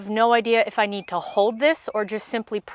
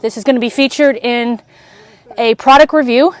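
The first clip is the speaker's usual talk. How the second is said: panting after a jog; breathless from running, words gasped; out of breath; 2 semitones higher, 8 dB louder than usual. Real, not acted. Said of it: "Oh my God, I'm so out of breath".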